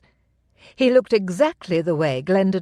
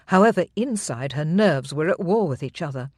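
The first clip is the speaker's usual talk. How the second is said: plain reading voice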